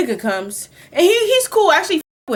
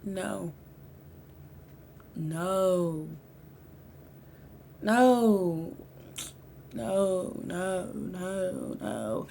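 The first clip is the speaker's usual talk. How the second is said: put-on drunk quiet denial; 6 semitones lower, 13 dB quieter, much slower than usual. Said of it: "I'm like".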